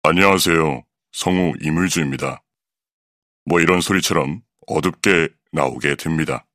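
darker voice with a lengthened vocal tract. Shown as a demonstration. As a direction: drop the larynx and darken the sound. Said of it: "후두를 내려 성도를 길게 만들어주면 ... 어둡게".

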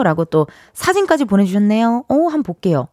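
ordinary talk, nothing marked

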